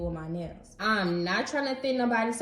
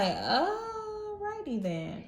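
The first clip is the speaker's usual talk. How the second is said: high, drawn-out singsong, softer; awkward acceptance; 10 semitones higher, 5 dB quieter, much slower than usual. Retold or imitated. Client reacting to the nail tech going silent, awkwardly accepting. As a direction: stretch it out high, dropping the subject.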